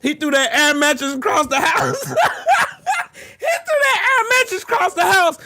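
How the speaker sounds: high, squealed words breaking into laughter; laughing hard. Real, not acted. Streamer tells of thrown air mattress, cracking up. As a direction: barely get it out for laughing. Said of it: "I ain't laughed this hard".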